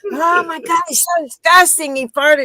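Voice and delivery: a woman in the lift, crying out in mock disgust; disgusted outcry. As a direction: act out being horrified by the smell. Retold disgusted reaction.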